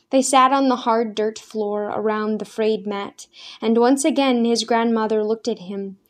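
plain reading voice

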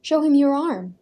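ordering. A narrator's reading. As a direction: tell the son firmly. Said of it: "the man ordered his son".

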